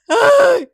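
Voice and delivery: sudden loud high cackle; loud burst of laughter. Real, not acted. Viewer reacting to the awkward scene, laughing out loud. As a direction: explode into real laughter.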